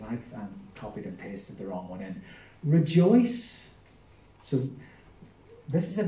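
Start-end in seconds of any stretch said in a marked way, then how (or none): 2.6-3.7 raised, ringing read of the first word; scripture reading. Acted. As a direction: proclaim it to the congregation. Starts scripture reading, breaks off.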